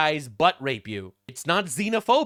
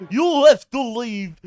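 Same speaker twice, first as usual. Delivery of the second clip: slow, louder pompous parody; mock Speaker; 4 semitones higher, 10 dB louder, slower than usual. Vlogger mimicking the Speaker ejecting an MP, mock pomp. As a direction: lord it over the room.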